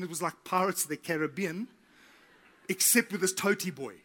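normal talking voice

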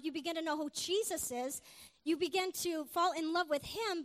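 ordinary delivery

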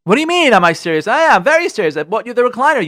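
indignant comeback in a quarrel; indignant defence. Acted. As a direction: protest that you meant well. Husband defends himself to wife.

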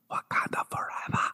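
unvoiced hushed whisper. Real, not acted. Recalling Okoye's line to co-host, whispered. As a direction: breathe it out, awed.

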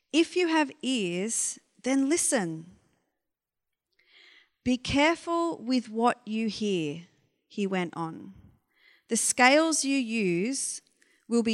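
loud, deliberate Bible reading; reading scripture aloud from the pulpit to the gathered church. Acted. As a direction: proclaim the passage to the congregation. Reads the passage aloud.

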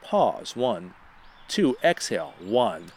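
slow, soft counted breaths; guided breathing. Acted. Host leading America through deep breaths, calm counting. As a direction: count the listeners through each breath calmly.

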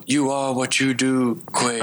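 calm wise mentor, lightly imitated; soothing philosopher voice. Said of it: "soothing philosopher voice".

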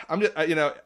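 ordinary delivery

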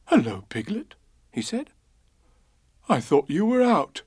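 a friendly bear, puzzled to find his friend home; Pooh Bear voice. A narrator's reading. Pooh's voice in the story.

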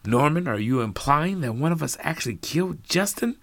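high shocked question. Acted. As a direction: gasp it out in disbelief. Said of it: "with shock in her voice".

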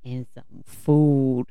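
stretched slow, silly voice; slow drawn-out. Pretend. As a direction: drag the words out playfully. Repeating partner's line in a new voice.

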